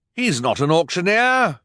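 high, loud outraged cry. A narrator's reading. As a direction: exclaim the discovery. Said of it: "Mister Poe cried".

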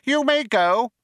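high mouse dismissal, sharp; Benji Mouse, sharp. A narrator's reading. Mouse sends old man away, sharply. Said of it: "said Benji Mouse sharply".

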